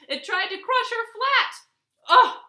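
high, loud, urgent narration; alarmed. A narrator's reading. Monster tries to crush Tortoise, high loud alarm. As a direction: build to a loud startled cry.

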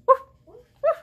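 loud high woofs; dog barking. Shown as a demonstration. Teacher barking like a dog for the children, loud and high. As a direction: bark like a puppy. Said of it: "Can you bark?".

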